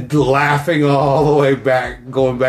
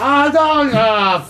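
shocked yelp; 10 semitones higher, much slower than usual. Retold or imitated. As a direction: yelp in mock horror. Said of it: "I was like".

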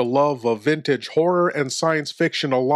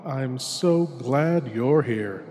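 hushed horror host; 4 dB quieter than usual. Acted. Horror host welcoming the lone listener into darkness, hushed and ominous. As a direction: draw the listener into the dark like a sinister host.

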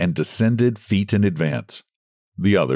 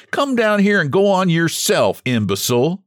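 angry retort; 10 semitones higher than usual. Acted. Quarrel on the slope.

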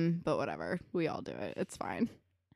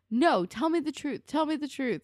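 insistent; 6 semitones higher than usual. Retold or imitated. Retold demand in heated argument.

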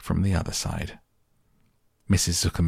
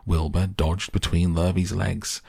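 usual speaking voice

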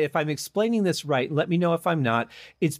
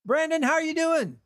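energetic show opener; 6 semitones higher than usual. Pretend. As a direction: greet the guest like a big announcement. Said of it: "Was that energetic enough?".

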